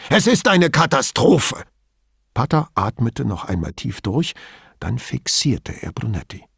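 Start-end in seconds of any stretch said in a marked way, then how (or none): 0.1-1.6 high, agitated retort; sarcastic outburst. A narrator's reading. Boss correcting his commissario about the incident, sarcastic and worked up. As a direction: exclaim it with biting alarm. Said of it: "gab Pater sarkastisch zurück".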